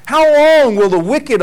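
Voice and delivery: high, loud cry of how long; anguished cry. Acted. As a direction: call it out to God in distress. Psalm cry, first take.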